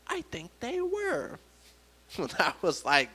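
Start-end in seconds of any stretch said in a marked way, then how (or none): 0.1-1.4 very high, soft, slow murmur; coworker lady. Retold or imitated. Coworker lady answers her own question, high and soft. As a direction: draw it out high and softly. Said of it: "she pulls me aside and she goes".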